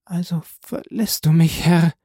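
high, tear-choked woman's voice; forlorn, high-voiced question; tearful. A narrator's reading. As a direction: ask it through tears, pitched high. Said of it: "mit einer von Tränen heiseren Stimme".

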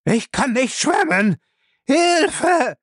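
high, slow terrified scream; screaming for help. Acted. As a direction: scream it while drowning. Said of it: "Sein Schrei".

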